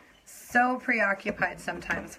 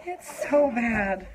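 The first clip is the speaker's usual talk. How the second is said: so stretched slowly and louder; milk the word so; slow, louder It's so bad; drawn out, emphatic; 5 semitones higher, 5 dB louder, much slower than usual. Real, not acted. Repeats emphatic.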